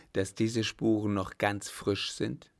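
ordinary delivery

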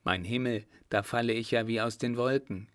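plain reading voice

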